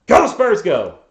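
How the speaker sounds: big, high shout of Go Spurs; team cheer. Real, not acted. Streamer cheering his team to chat, loud and high. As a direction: belt it out like a fan in the stands.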